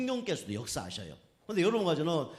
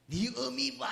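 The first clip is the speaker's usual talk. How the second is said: retold cursing; 8 semitones higher, slower than usual. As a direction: imitate someone hurling a parent insult. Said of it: "막 하면 욕을 하는 거예요". (높은 톤으로 욕하는 흉내)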